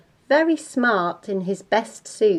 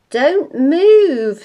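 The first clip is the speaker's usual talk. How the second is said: high, loud warning; alarmed mother's command; 7 semitones higher, 9 dB louder than usual. Acted. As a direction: freeze the child with a sharp order. Mother alarmed at baby with make-up.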